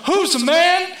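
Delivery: high, loud call across the mall. Retold or imitated. Leaning out the shop window to hail the pastor, a loud call. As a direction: lean out and shout the question.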